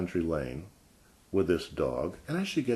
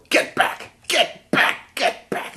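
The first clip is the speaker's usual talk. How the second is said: high, loud shriek of get back; imitating a shrieking woman; 5 dB louder, much slower than usual. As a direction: shriek like a crazed neighbor yanking her dog. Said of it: "she would yell".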